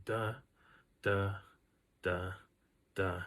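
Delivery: single "Da"s with long gaps between; slow footstep beat. Retold or imitated. Walker imitates the follower's one step to two, slow beat. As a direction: sound out slow, widely spaced steps. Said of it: "taking one step for every two".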